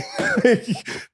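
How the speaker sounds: high-pitched burst of laughter; real high laugh. Real, not acted. Laughing at own dunk description.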